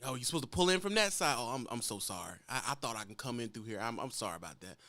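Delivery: softening into a meek, apologetic mumble; a mild imitation of a driver apologizing at the gate; humble apologizing driver. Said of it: "That's why they talk like that".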